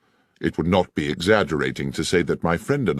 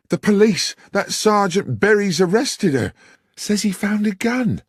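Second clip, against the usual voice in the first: put-on much higher, louder breathless young girl; distraught young woman; 5 dB louder than usual. Young woman tells the landlord his son's betrothed is taken, panicked. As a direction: blurt it out in a panic.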